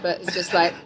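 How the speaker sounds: usual speaking voice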